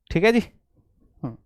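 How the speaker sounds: normal talking voice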